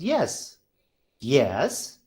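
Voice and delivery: each YES swooping up from low; questioning YES, pitch climbing to the end; rising tone. Shown as a demonstration. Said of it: "question mark".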